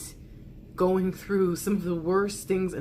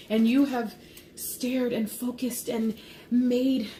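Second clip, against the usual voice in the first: fervent, building praise; passionate channeled message; 5 semitones higher, 3 dB louder than usual. Acted. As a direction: pour it out with rising fervour.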